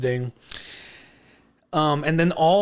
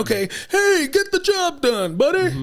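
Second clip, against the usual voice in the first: mock movie tough guy. Riffing on the actor playing a businessman, high hammed-up pushy voice. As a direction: push the caricature way up in pitch. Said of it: "it suits him to be like".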